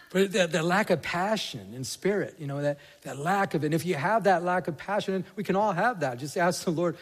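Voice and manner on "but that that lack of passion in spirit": normal talking voice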